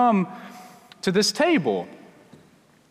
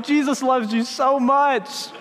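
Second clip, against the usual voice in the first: high, drawn-out mock reassurance; overly sweet backpedal, pitched up and slow; scrambling to recover; 5 semitones higher, slower than usual. Pastor trying to win back a startled child, comically reassuring. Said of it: "trying to figure out how to recover".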